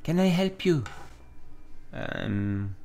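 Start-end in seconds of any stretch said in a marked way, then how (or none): 0.0-0.8 put-on higher, feminine take on a French accent; Villanelle. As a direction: offer help in a high French accent. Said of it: "gonna give Villanelle a French accent".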